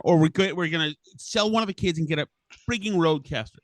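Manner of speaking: usual speaking voice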